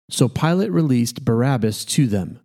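ordinary delivery

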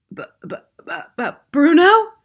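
halting stutter leaping to a loud, high name; stammer, then burst out high. A narrator's reading. Father seeing his mouse son, stunned stammer. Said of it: "stammered Mister Jenkins".

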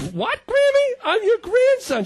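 high, loud disbelieving cry; outraged grandson squeak; shocked. Retold or imitated. Shocked reply retold.

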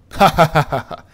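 loud laugh. Real, not acted. Laughing at story climax.

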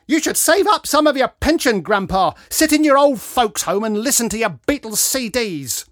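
loud, high-pitched taunt; jeering. A narrator's reading. Woman mocking grandpa at the concert, jeering. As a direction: taunt the old man loudly.

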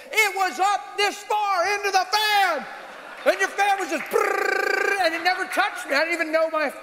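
high-pitched agitated retelling; freaking out. Retold or imitated. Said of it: "they're freaking out".

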